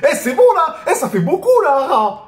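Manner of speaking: high, laughing exclamations; laughing disbelief. Real, not acted. Fan laughing at the hero taking arrows, high-pitched. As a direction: laugh in shock at the hero's recklessness.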